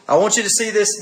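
normal talking voice

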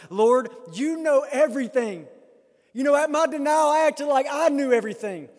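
loud, high plea to the Lord; full-force preacher voicing a confession; impassioned preaching. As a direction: cry out Peter's confession. Acts Peter's plea at full force.

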